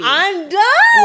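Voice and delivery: high, loud laughing cry of I'm done; squealing with laughter; cracking up. Real, not acted. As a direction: laugh it out, totally overcome. Laughing reaction to friend's joke.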